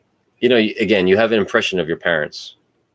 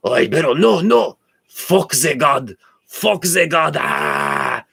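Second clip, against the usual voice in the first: put-on cousin's Arabic-French accent; 8 semitones higher, much slower than usual. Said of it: "I'm gonna do the best I can in his accent".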